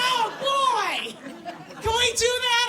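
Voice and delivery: mock giddy excitement. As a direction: squeal it like great news. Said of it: "And I'm like".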